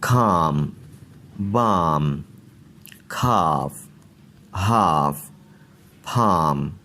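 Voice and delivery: silent L, long aa: खाम, बाम, खाफ, हाफ, फाम; model pronunciation. Shown as a demonstration. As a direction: read each word with a broad aa. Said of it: "यहाँ पर देखें". (लंबी आ, एल खामोश)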